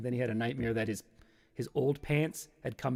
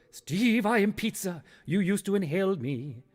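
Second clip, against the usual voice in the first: higher, theatrical pizza apparition; haunting pizza; 6 semitones higher than usual. Acted. Pizza comes back to haunt Steve, haunting musical voice. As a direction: intone it like pizza haunting an old friend. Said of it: "And then Pizza goes".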